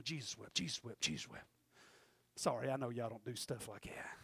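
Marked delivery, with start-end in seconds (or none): 0.0-1.4 low, soft repeated mutter; muttering to himself. Retold or imitated. Nervous candidate rehearsing his verse in his mind, muttering. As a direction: rehearse it under your breath, over and over. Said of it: "in my mind going".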